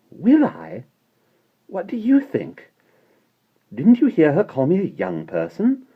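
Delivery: high, loud affronted scorn; disgusted indignation. A narrator's reading. Mary Poppins scoffing at being called a young person. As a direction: bristle at the insult.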